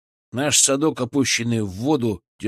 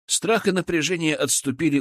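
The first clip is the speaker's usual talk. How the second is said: ordinary delivery, nothing marked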